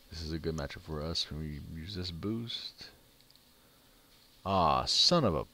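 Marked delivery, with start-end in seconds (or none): 4.4-5.4 loud, annoyed curse cut short; frustrated outburst. Real, not acted. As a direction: snap it out at the bad luck. Losing a tough game matchup.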